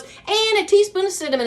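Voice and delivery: high, lilting mock cooking host; imitating the lady. Imitating the recipe lady, a high lilting voice. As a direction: sing it out like the TV cook. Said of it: "she goes".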